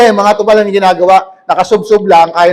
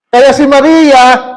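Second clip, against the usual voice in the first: slow, louder, higher preaching emphasis; 6 semitones higher, 6 dB louder, much slower than usual. Real, not acted. Preacher to congregation, slow emphatic build. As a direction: drag it out for the crowd. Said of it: "preacher stretching the name".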